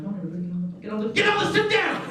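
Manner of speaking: rising to a high frantic "sit down!"; impatient urging. Retold or imitated.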